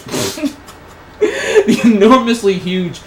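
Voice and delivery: high, loud, slowed by laughter; cracking up. Real, not acted. Reader losing it at a lewd fan fiction line, cracking up. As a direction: try to read on through helpless laughter.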